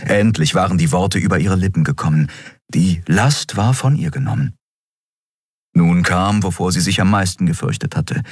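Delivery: ordinary delivery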